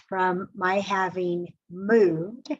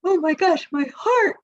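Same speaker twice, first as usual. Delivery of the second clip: high, loud swoon, hand on heart; overwhelmed by a small gift, high and loud; mock swooning boyfriend; 10 semitones higher, 5 dB louder than usual. Boyfriend touched by a blondie, high loud swoon. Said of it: "grabbed his chest".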